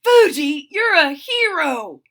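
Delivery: loud, high cheer; cheering bark. A narrator's reading. Freddie cheering Fuji after the rescue, excitedly. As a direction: cheer it out for a friend, big and high. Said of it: "the other puppies cheered".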